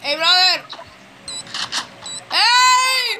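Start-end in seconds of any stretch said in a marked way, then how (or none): 2.3-3.2 shout. Real, not acted. Calling teammate out.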